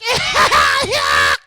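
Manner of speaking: high exaggerated cackle; high, silly burst of held-back laughter; mock delayed laugh. Audience member finally laughs at home, silly cackle. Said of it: "And they get home like".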